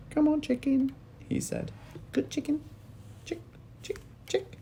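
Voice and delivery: high, gentle coaxing; coaxing the chicken. A narrator's reading. George coaxes a chicken with a spoon, high and gentle.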